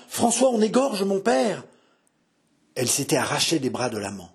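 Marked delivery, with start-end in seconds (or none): 0.1-1.6 high-pitched cry for help; urgent, panicked call to a lover; alarmed cry. Acted. Daughter calls François because her father is being killed, alarmed cry.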